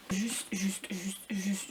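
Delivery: juste, juste, soufflé tout bas; chuchoté. Real, not acted. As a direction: murmurer comme un secret. Aparté complice avant la couverture. (chuchotement taquin avant de parler de la couverture)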